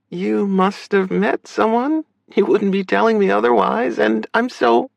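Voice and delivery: high-pitched sister character voice; accepting, high female voice; supportive sister. Acted. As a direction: play her high, encouraging him.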